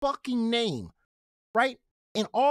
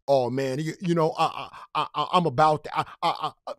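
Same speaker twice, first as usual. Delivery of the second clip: mock stutter; 6 semitones lower than usual. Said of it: "No, he stuttered".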